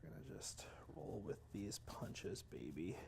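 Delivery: fast hushed whisper. Real, not acted. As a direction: mutter it to yourself. Whispered self-talk.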